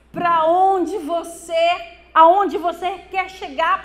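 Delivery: preaching cry. Real, not acted. Pastor challenging listeners about their direction, loud preaching. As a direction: push the question out to the crowd.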